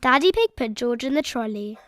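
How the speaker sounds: ordinary delivery, nothing marked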